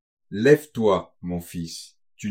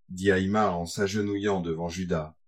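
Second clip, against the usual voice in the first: ordinary delivery, nothing marked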